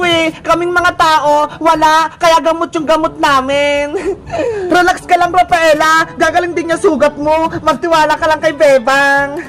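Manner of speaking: ordinary talk, nothing marked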